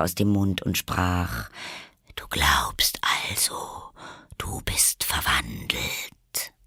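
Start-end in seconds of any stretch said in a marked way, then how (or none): 2.2-6.5 low, slow whispery drawl; sleepy caterpillar. A narrator's reading. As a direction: contradict drowsily, half whispered. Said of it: "mit schläfriger, langsamer Stimme". (tief, langsam und schläfrig gehaucht)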